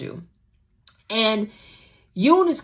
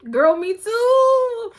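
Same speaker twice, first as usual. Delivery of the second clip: gushing excitement; 13 semitones higher, 5 dB louder, much slower than usual. Real, not acted. Fan swooning over Heejun's words, thrilled and squealing. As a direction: swoon over the line with delight. Gushing reaction to quoted line.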